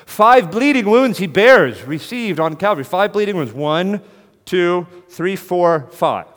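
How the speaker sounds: loud, high, slow proclamation; full-force preaching. Acted. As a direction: hammer each word out slowly, counting the wounds. Preaching the hymn loudly.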